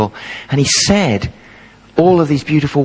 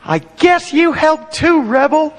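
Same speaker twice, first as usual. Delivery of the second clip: put-on much higher, slow, grudging kid's voice; 10 semitones higher, slower than usual. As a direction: concede it reluctantly like a selfish boy. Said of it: "selfish little kid every week would go".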